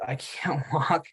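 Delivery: slowed, breaking into a laugh mid-line; can't keep a straight face on it; half-laughed mermaid line; laughing through the line. A narrator's reading.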